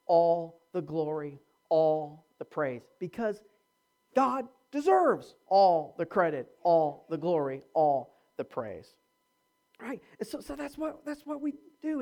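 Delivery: ordinary talk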